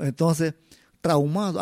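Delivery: ordinary talk, nothing marked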